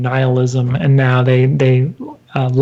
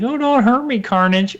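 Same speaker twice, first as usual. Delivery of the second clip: mock cowering; 7 semitones higher than usual. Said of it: "pretends to cower".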